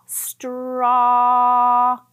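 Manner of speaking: Straw said slowly with a long aw sound; drawn-out straw. Shown as a demonstration. Teacher stretching out Straw for first graders. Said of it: "listen to the sound at the end of straw".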